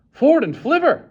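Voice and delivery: high, loud startled swear; oath. A narrator's reading. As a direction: blurt a curse. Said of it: "he swore".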